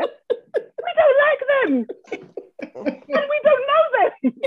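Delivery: very high, slow, laughing through the words; drawn out amid giggles. Real, not acted. Retells call, still laughing.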